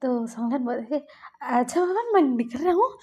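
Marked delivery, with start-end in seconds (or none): 1.4-2.9 higher, louder acted protest of giving in; placating. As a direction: placate someone who is chasing you off. Acted placating while being chased off.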